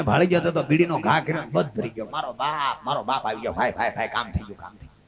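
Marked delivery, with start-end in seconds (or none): 2.1-5.0 weak patient. Retold or imitated. Injured friend moaning in hospital.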